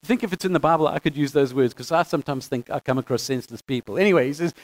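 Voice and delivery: normal talking voice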